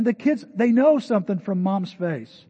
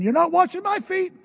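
much higher, louder objection; high, loud refusal as Peter; indignant protest; 13 semitones higher, 5 dB louder than usual. Retold or imitated. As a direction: Peter flatly refusing, pushing back hard. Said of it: "Peter starts saying".

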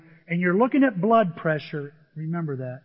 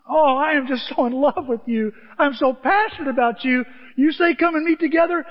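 gushing love; 7 semitones higher, 5 dB louder than usual. Acted. Psalmist gushing with love for God.

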